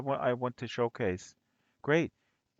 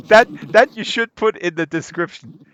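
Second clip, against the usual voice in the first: laughing loud tease; 11 dB louder than usual. Real, not acted. Mock suggesting room description.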